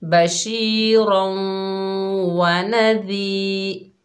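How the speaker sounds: slow chanted बशीरौं व नज़ीर with the long vowel stretched; Quranic recitation. Shown as a demonstration. Teacher reciting the verse words for the class, slow melodic chant. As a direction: recite it slowly and melodically, holding the madd. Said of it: "एक अलिफ की मिकदार खींच कर पढ़ेंगे".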